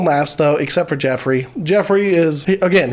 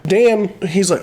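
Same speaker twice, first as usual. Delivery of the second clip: ordinary talk, nothing marked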